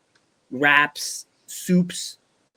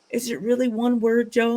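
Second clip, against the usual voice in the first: higher-pitched questioning follower voice; imitated followers; 8 semitones higher than usual. Followers asking host about one word, imitated questioning voice. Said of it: "they go".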